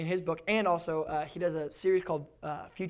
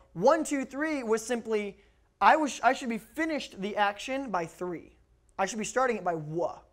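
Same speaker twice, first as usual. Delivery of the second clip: ordinary talk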